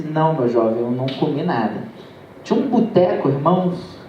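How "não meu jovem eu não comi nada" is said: put-on frail old man. Hungry old man answers pastor.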